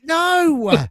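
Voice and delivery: sharp, high, loud no; shouted no. Real, not acted. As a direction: cut the confusion off with a high shout. Shouting down the Greece mix-up.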